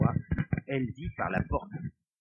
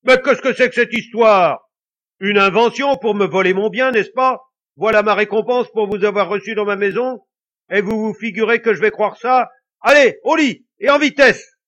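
loud, much higher furious ranting; ghost in a rage; 9 semitones higher, 15 dB louder than usual. A narrator's reading. Angry ghost driving the couple off to bed, furious ranting. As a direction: rage at them like a robbed miser. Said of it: "le fantôme se mit en colère".

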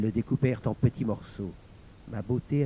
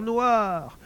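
usual speaking voice

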